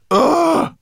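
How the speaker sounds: loud, high "ugh"; shuddering yelp of disgust; disgusted groan. A narrator's reading. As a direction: burst out in disgust.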